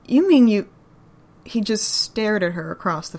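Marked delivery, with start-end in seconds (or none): 0.1-0.7 shocked outburst. Acted.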